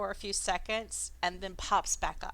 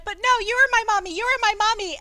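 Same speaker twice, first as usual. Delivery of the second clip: high, loud little creature voice; pixie; 12 semitones higher, 11 dB louder, faster than usual. Acted. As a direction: squeak it out like a tiny sea creature. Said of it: "the Squinton's like".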